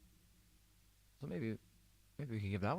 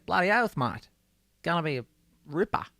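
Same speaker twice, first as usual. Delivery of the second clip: loud, high Australian accent, cheerful; Aussie accent; 7 semitones higher, 5 dB louder than usual. Shown as a demonstration. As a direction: play a beaming Australian bloke. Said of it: "That's your Australian phrase for today".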